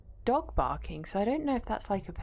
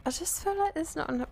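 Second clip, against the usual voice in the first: higher, teasing re-run of her own line; mock repeat; 9 semitones higher than usual. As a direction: take the piss out of yourself. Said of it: "Was I taking the piss?".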